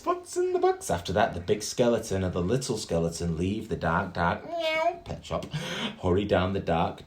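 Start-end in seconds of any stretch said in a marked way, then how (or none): 0.0-0.9 very high squeak of curiosity; squeaky curiosity. Acted. Little skeleton wonders about the box, squeaking very high. 4.5-5.0 very high slow meow; cat meow. Acted. Narrator leaves the pet shop with a high meow.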